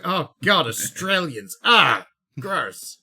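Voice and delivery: hammed-up groan of dismay; exaggerated revulsion at unwelcome guests; mock disgust. Imagined Danes reacting to Australian visitors, mock disgusted.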